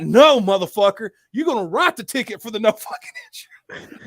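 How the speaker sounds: high-pitched indignant refusal, loud at first; heated mock protest; retold mad outburst. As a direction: reenact yourself getting mad, breaking into laughter. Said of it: "Then I get mad".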